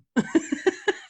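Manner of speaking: airy rising giggle; light laugh. Real, not acted. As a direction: giggle at the joke. Laughs at own joke.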